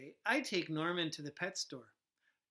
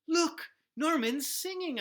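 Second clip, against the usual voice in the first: high, loud excited exclamation; thrilled kid pointing; 9 semitones higher, 7 dB louder than usual. Acted. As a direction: burst out with delight. Excited boy points out fish.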